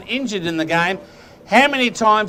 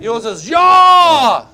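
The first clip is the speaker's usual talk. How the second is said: slow, loud, high yell; shouted call; 5 semitones higher, 8 dB louder, much slower than usual. Shown as a demonstration. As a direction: bellow it out slowly. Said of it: "I forgot mine".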